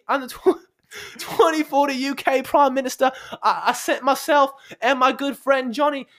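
high, hammed-up British accent as a future prime minister. Podcast host playing a future British prime minister, hammed-up British accent. Mock British PM character bit.

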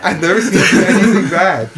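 high, loud, through laughter; laughing. Real, not acted. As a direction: repeat the point while cracking up. Laughing after the film quote.

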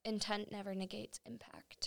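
dramatic hushed whisper. Acted. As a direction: breathe it like a secret. Solemn aside, sudden whisper.

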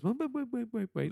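high-pitched, rapid-fire mock protest; mock urgent halting. Voicing the American one against no sequel, mock urgent halting.

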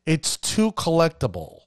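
ordinary delivery

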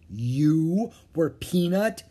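slow, rising disbelief; amazement. A narrator's reading. Annie learning Morgan was the mouse, amazement. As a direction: stretch it out into an astonished question.

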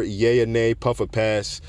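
ordinary talk, nothing marked

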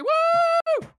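very high, drawn-out whoops; mock cheer. Mocking Brexiteers over their vaccine, hammed-up high whooping.

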